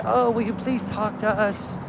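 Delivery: soft, slow, overly polite ask; mock timid politeness. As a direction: imitate a meek, hesitant request. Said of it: "It doesn't work when we say".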